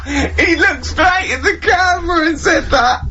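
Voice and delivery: high-pitched, drawn-out words through laughter; helpless laughter. Real, not acted. Cracking up at co-host's remark to the camera, helpless laughter. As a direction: gasp it out while cracking up.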